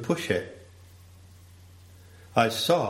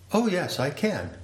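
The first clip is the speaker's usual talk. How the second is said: brightly higher, keen to help; eager host; 8 semitones higher than usual. Pretend. Host answering the guest's request, eagerly agreeable. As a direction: answer cheerfully, a lightly voiced teacher. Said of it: "T T replies".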